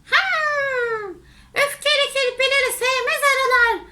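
high-pitched bee voice; a high, disapproving hum, then quick squeaky words; quick, high little bee. Acted. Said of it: "arılar dedi ki".

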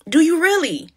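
REALLY pressed high and doubtful; pointed repeat. Shown as a demonstration. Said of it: "But do you really?".

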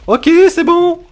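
hammed-up fright at Godzilla; startled alarm. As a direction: gasp out in alarm. Godzilla appears.

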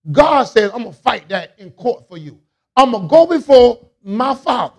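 loud, high, driving preacher's cadence; full-force preaching. Acted. Pastor to church, preaching at full force. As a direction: preach it hard to the congregation.

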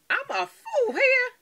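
high, slow silly voice; acting a fool. Shows her viewers she is a fool, high silly voice. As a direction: ham it up like a clown. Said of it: "I just like acting a fool".

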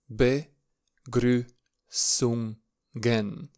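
"Begrüßungen" drawn out very slowly, each syllable apart. Shown as a demonstration. Teacher introduces the lesson title for beginners, slow syllable by syllable. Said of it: "încet".

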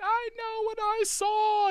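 very high, slow mock falsetto; earnest witness. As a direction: draw it out as a pleading witness. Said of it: "It's not just somebody be like".

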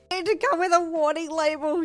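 high, loud teasing screech, embarrassed; playful screech. Acted. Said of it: "you screeched playfully".

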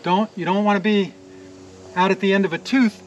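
usual speaking voice

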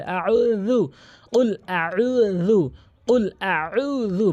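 very slow কুল আউযু, each sound drawn out; slow recitation drill. Shown as a demonstration. Teacher having students practise with him, slow chant. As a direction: recite it slowly for pupils to copy. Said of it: "বারবার practice করবেন আমার সাথে".

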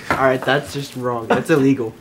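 ordinary talk, nothing marked

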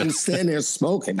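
chuckling through the line; laughing. Real, not acted. Telling a friend about the smoker, laughing. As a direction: laugh while mocking the smoke.

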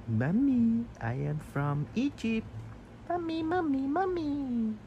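very high calling voice; high cat calls. Acted. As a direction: squeak out the calls like a kitten.

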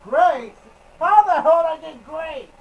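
loud, baffled exclamation; surprised outburst. Real, not acted. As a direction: shout it out in disbelief. Surprised at a great rating.

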